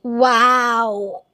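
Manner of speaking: ordinary talk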